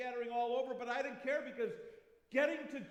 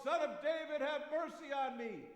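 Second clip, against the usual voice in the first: higher, louder cry for mercy; calling out; 6 semitones higher, 4 dB louder than usual. Acted. Blind beggar calling to Jesus over the crowd, loud pleading call. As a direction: call across the crowd to be heard. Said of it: "and so I yelled out".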